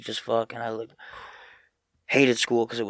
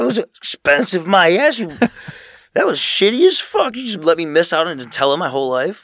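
loud, raised indignant rant; mock yell; 7 semitones higher, 8 dB louder than usual. Son ranting at mom about missing Nutella. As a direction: keep ranting at her in mock hurt.

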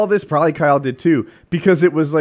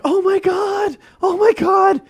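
high, loud excited fan squeal; starstruck fans; 8 dB louder, slower than usual. Retold or imitated. Fans on the street spotting him, squealing high. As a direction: gush like a fan spotting a celebrity. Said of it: "people on the streets are like".

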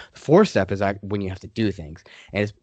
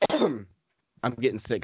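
ordinary talk

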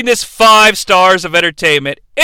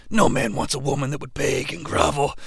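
much softer, low menacing promo voice; Jake promo; 6 semitones lower, 10 dB quieter than usual. Retold or imitated. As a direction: murmur it like a creepy villain. Jake's low raspy promo voice.

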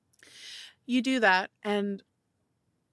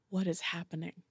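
stunned quiet; 3 semitones lower, 10 dB quieter than usual. Acted. Stunned dad asks his child.

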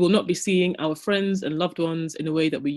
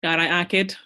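put-on Mancunian accent. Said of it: "I can't do a Mancunian accent".

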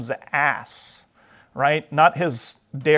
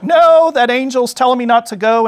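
high, loud, balking refusal; high-pitched comic donkey protest; alarmed donkey; 7 semitones higher, 7 dB louder than usual. Acted. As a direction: protest loudly like a stubborn, spooked animal.

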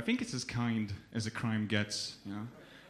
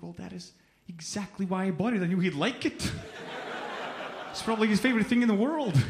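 incredulous retort; 7 semitones higher than usual. Retold or imitated. Man defending buying a beer for a homeless man, incredulous. Retells arguing back at angry woman.

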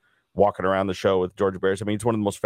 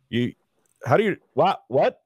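high, stammering disbelief; stunned disbelief; 3 semitones higher than usual. Retold or imitated. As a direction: sputter like you can't believe they know you. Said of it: "And I was like".

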